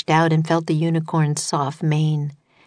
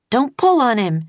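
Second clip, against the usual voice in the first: higher, urgent cry; yell; 7 semitones higher than usual. A narrator's reading. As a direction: shout it to stop him. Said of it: "yelled Annie".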